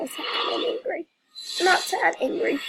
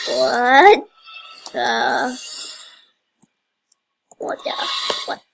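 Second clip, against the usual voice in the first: startled what; 3 semitones lower, 4 dB louder, slower than usual. Real, not acted. Shocked by game event.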